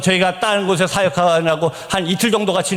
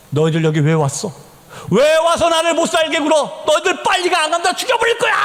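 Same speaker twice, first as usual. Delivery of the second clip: retold demonic shouting; 8 semitones higher than usual. Possessed youth driving the missionaries away, retold rising shout. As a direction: build to a possessed, menacing yell. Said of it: "막 소리 지릅니다".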